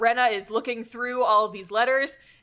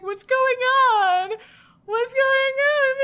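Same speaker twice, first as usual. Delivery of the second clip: high, loud, drawn-out mock wail; imitating a distraught anime girl; confused Suzune; 5 dB louder than usual. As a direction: whine it out in hammed-up bewilderment. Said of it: "Suzune's just confused".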